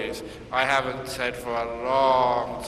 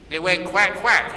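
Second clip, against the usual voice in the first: louder quack imitation; playful quacking; imitated quack; 5 semitones higher, 6 dB louder than usual. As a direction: mimic the duck. Duck sound in story.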